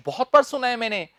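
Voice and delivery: normal talking voice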